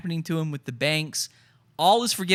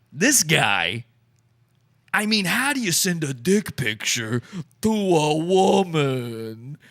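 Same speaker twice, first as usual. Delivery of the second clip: mock outrage; 2 semitones higher than usual.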